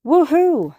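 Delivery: high, loud whoop; excited high-pitched whoop; cheering. A narrator's reading. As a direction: celebrate like a fan. Cheering text message.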